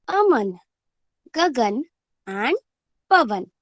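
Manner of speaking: each word read out slowly, one at a time; teacher reading words to first graders; slow word-list reading. Shown as a demonstration.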